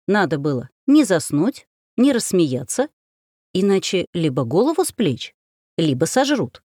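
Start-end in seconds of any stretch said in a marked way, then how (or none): none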